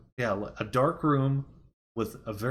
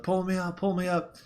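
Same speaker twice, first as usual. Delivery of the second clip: retold panic; 8 semitones higher than usual. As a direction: imitate someone frantic to be pulled up. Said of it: "he starts freaking the heck out".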